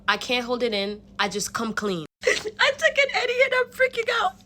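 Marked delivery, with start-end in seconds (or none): 2.2-4.3 high, teary, frantic confession; panicked confession. Retold or imitated. Confessing to her dance teacher, panicked and tearful. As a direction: let it burst out close to tears.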